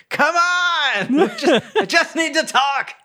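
high, strained yell with laughter; mock yelling. Leaf yelling through the window at his friend, pleading. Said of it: "Leaf is still yelling through the window".

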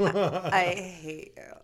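put-on slow, drawn-out Valley girl voice; exaggerated Valley girl. Shown as a demonstration. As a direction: lean into the Valley girl accent. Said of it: "You sound like a Valley girl".

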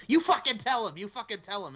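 put-on shouted Boston accent then dropping off. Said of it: "in the Boston accent".

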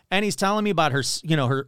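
ordinary talk

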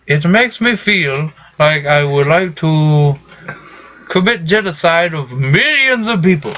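an Indian accent in a mock comic villain role. Counselor asks how he feels, he answers in an Indian accent. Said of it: "my, like, Al Qaeda voice".

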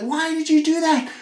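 high-pitched imitated holler; mock a yelled question from another room; wife yelling back. Wife questioning husband's mistake, imitated yell back. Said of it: "she yelled back, like".

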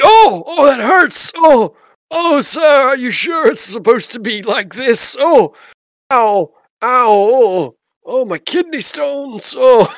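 loud pained moaning, high; pained groaning. Acted. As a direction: groan and wail in pain as the mugger. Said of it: "And now he's like".

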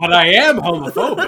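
laughing through the line. Pretend. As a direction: laugh while saying it.

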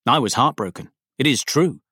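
usual speaking voice

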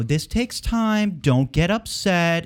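slow, high, preachy mock lecture; sing-song patronizing mimicry; mocking condescension. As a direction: sneer it like a scolding official. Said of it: "talking with this sort of frustrated authority".

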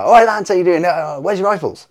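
high, loud hail to the lads; mock-innocent calling out. Teller teasing soldiers whose guns he hid, mock-innocent calling out.

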